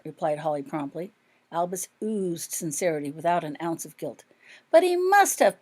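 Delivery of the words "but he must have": higher, louder indignant protest. A narrator's reading. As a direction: Rose pushing back hard. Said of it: "protested Rose".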